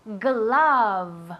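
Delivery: Glove with a throaty g and the top teeth on the lip for the v; model pronunciation. Shown as a demonstration. Teacher modelling the word for the class, clear g and v. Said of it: "La G ... y la V".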